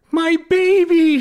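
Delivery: high, loud, drawn-out wail; cried. Acted. Mother crying out for her crushed baby, anguished wail. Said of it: "she cried".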